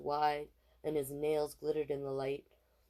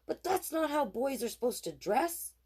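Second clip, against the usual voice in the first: put-on indignant girl; 10 semitones higher than usual. A narrator's reading. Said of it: "she said".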